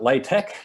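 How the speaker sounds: LaTeX said as "LAY-tek"; pronounced LAY-tek. Shown as a demonstration. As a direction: say LaTeX with a long "lay", ending in a hard k. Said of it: "you pronounce it LaTeX".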